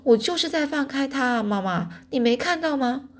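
high, protesting yell; crying out. A narrator's reading. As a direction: protest to mom in exasperation. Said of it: "我就大叫说".

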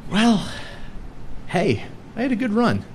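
usual speaking voice

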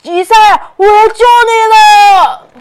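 loud, high heroic shout. Acted. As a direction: yell it out over the river. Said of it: "欧奇大喊着".

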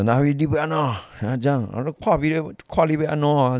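high-pitched retold challenge; confrontational challenge. Porter comes over to the quarrelling diner, acted confrontational challenge. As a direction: imitate a brawler picking a fight.

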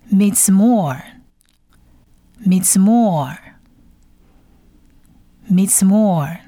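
"Mizmor" said slowly, long final vowel; Hebrew pronunciation. Shown as a demonstration. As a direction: say "Mizmor" as separate careful model readings. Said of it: "他第一个字是诗". (希伯来语示范读音，慢读“Mizmor”)

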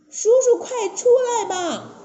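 very high, loud-ish plea; high, strained storybook begging; pleading princess. Acted. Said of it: "铁扇公主张嘴等着说".

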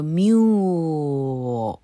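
mule stretched out very long; exaggeratedly slow. Shown as a demonstration. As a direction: draw mule out slowly to show each sound. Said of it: "但没念这么慢". (mule放得很慢、拉长音示范)

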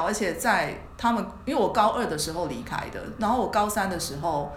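ordinary talk, nothing marked

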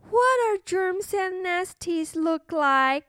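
put-on high curious little girl; cartoon princess. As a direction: ask like a wide-eyed princess. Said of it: "said the little princess".

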